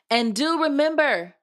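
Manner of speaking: high, emphatic reminder; fairy godmother. A narrator's reading. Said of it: "said the fairy".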